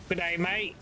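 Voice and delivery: lightly put-on Aussie greeting; hint of a hammed-up Australian twang; mock Australian accent. Shown as a demonstration. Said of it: "Not Australian".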